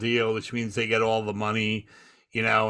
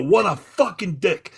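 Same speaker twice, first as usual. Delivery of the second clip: higher, mock outraged venting; retold ranting; 7 semitones higher, 3 dB louder than usual. Comedian keeps venting on a live mic, retold ranting. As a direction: retell yourself going off, punchy. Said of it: "I'm just going off".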